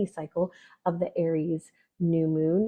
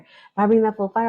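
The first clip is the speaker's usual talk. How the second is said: louder, punching out the fire line; mock burst of fire; 3 semitones higher, 7 dB louder than usual. Fiery person imitating their own too-big outburst, louder. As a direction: let the fire blast out for a moment.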